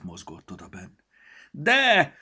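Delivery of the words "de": high, loud outraged yelp; indignant cry. A narrator's reading. Storybook indignantly insisting to Petya that he kidnapped it. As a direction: protest sharply, squeaky and loud. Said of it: "kiáltotta felháborodottan".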